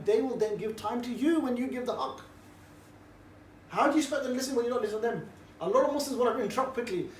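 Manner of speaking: ordinary talk, nothing marked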